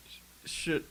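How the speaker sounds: breathy whispered start. Real, not acted. The teller unsure what to do, whispering. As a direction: whisper it to yourself.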